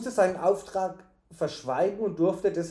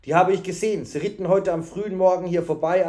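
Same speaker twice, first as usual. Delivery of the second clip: eager witness; 3 semitones higher, 6 dB louder than usual. A narrator's reading. Man at table eagerly reports.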